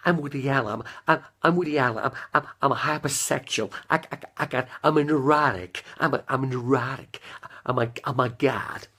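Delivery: stammering, hammed-up neurotic comedian; Woody Allen. Declaring himself a neurotic to viewers, stammering comedian impression. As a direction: stutter and restart like a fretful neurotic. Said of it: "I did my, my Woody Allen".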